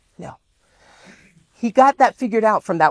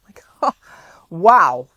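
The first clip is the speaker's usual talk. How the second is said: wow through a laugh; 5 dB louder than usual. Real, not acted. Speaker to audience, amazed at the offer, laughing. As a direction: laugh it out in disbelief. Laughing at his own luck.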